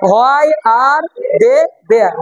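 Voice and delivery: each word very slow and separate; drawn out slowly for learners; slow breakdown. Shown as a demonstration. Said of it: "আগে ছোট করে বুঝে নাও".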